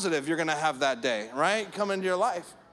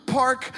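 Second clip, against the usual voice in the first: sobbing gasp; 5 semitones higher, 5 dB louder than usual. Retold or imitated. Child sobbing out sibling's name.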